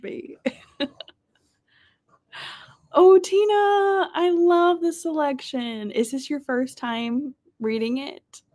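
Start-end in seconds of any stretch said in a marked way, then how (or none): 2.9-5.9 high, stretched-out delighted hello; sing-song high welcome to a viewer; delighted greeting. Real, not acted.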